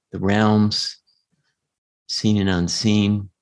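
guided meditation voice. Acted.

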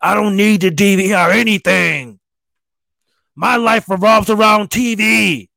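wife swearing she needs no DVR, mock yell. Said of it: "my wife was like".

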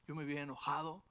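normal talking voice

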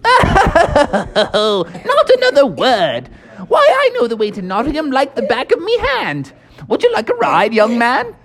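put-on high, hearty old English toad; jolly Mister Toad. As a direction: boast like a merry old gentleman toad. Mister Toad voiced in story.

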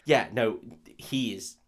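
usual speaking voice

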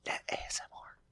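hushed ASMR-style whisper; soft whisper into the mic. Pretend. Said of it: "When you whisper".

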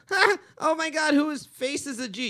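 put-on high, loud taunting kid, slowing on the name; imitating a snotty kid laughing at a gamertag; mocking gamer kid. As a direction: jeer like a bratty kid in live chat. Mocking gamer kids quoted.

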